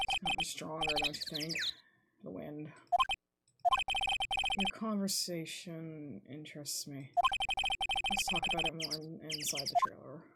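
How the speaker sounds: luring them somewhere private; cold witness, hushed. Acted. Witness inviting the lawyer into the trailer, low and soft.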